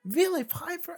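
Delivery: high, loud, slow stunned yell; shocked scream. Pretend. Reacting to the cameo, a high slow shocked scream. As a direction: leap up and shriek it. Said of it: "jump out of my seat and just scream".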